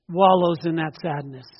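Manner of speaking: ordinary delivery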